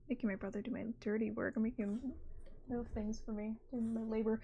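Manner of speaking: soft unvoiced whispered aside. Real, not acted. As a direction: whisper it so he can't hear. Whispered aside while brother helps.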